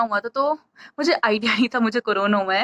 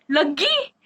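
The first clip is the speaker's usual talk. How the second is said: surprised question; 4 semitones higher, much slower than usual. Retold or imitated. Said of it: "तो मैंने कहा".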